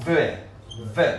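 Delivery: THE with a buzzing voiced th; voiced th demo. Shown as a demonstration. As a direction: model THE with tongue between the teeth. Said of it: "صدای ز".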